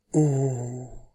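a hushed, awed oh; overwhelmed oh. A narrator's reading. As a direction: let the wonder take your breath. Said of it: "Und dann ganz überwältigt".

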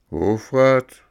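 loud curt title; gruff director snapping back; gruff correction. A narrator's reading. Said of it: "gab dieser barsch zurück".

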